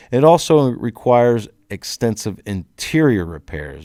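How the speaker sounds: slow, deliberate delivery with clear emphasis on "interior"; stress on INTERIOR. Shown as a demonstration. Contractor reading his opening statement to adjusters, emphasis on "interior". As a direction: lean hard on "interior", slowing the phrase down. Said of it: "putting some emphasis on the word interior".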